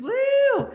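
very high whoop; high woo cheer. Real, not acted. Host whooping to hype viewers, high cheer. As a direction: let out a gleeful woo. Cheer after sung line.

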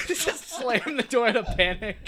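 words broken by laughter; laughing. Real, not acted. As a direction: laugh through it. Laughing at panicked plan.

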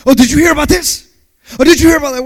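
high, loud, fast gossiping voice; mock gossip. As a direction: imitate an eager church gossip. Mocking gossiping churchgoer.

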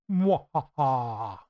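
slow, loud mock evil laugh; evil villain laugh. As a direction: cackle like a cartoon villain. Villain laugh after spooky reading.

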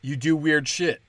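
ordinary talk, nothing marked

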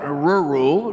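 Rural drawn out, lips pinched round the R; demo pronunciation. Shown as a demonstration. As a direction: say Rural slowly for the class. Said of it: "We gotta pinch it out".

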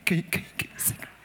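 voiceless whisper, only consonants heard; tiny hushed whisper with crisp consonants; barely audible whisper. Shown as a demonstration. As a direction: whisper so only the C clicks through. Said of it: "I should only hear the c".

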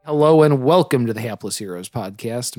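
normal talking voice

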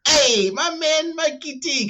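Zorchi's greeting. Retold or imitated. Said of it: "he just goes like".

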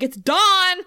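high, loud mock shout; hammed-up outburst; mock blurt. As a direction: blurt the secret out in a mock yell. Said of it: "She's not been like".

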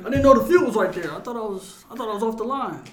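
hammed-up innocent excuse; fake innocence. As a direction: play dumb after tripping him.

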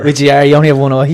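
loud jibe through laughter; laughing tease. Real, not acted. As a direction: tease him loudly, laughing. Teasing jibe amid laughter.

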